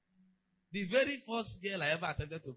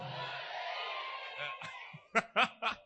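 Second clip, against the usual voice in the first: big shouted laugh. Real, not acted. Pastor laughs at his own story.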